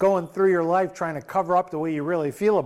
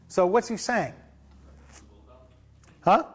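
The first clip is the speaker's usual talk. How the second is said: ordinary talk, nothing marked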